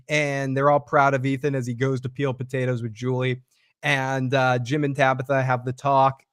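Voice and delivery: normal talking voice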